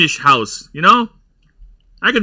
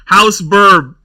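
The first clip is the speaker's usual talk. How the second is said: mock herald proclamation; 3 semitones higher, 5 dB louder than usual. Mock royal house proclamation.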